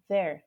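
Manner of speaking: "There" said quickly with a light buzzing TH; natural voiced TH. Shown as a demonstration. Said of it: "quando for falando mais rapidinho".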